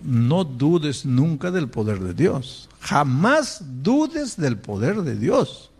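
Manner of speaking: drawn out, every word weighed; slow, solemn insistence; slow emphatic. Acted. Preacher slowly urges the congregation never to doubt God.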